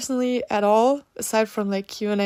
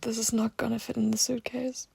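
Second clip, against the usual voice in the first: whispering; 7 dB quieter than usual. Real, not acted. Packing, muttering to herself.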